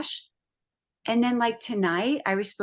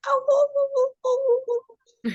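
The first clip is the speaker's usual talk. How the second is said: beagle crying; 3 dB louder than usual. Retold or imitated. Woman imitating her neighbour's crying beagle, high mournful howl. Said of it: "His cry is like".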